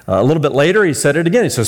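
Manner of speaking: ordinary delivery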